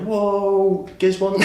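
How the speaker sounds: high, loud astonished gasp of a word; mock amazed whoa. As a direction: act blown away by the price. People reacting to low price.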